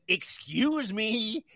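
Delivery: loud, high, indignant Karen. Retold or imitated. Passenger confronting the family on a plane, loud Karen voice. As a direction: stretch the opening, then build to a loud demand. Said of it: "putting on my Karen voice".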